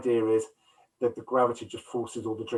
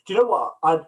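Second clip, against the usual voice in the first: usual speaking voice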